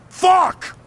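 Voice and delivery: angry outburst. Acted. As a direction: spit it out in sheer frustration. Donnie alone, frustrated outburst.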